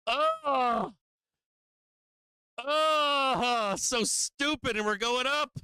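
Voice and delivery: high, loud frustrated cry; exasperated outburst. Real, not acted. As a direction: blurt it out in disbelief. Frustrated trader watches market rise.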